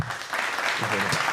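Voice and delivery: slow breathy whisper; whispered repeat. Shown as a demonstration. Comedian whispering the callback to himself, slowly. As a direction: savour it under the breath.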